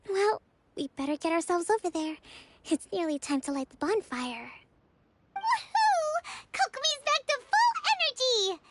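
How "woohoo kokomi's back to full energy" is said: high, louder whoop of joy; bright cheer; excited cheer. Acted. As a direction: celebrate her recovery. Cheering Kokomi's recovery.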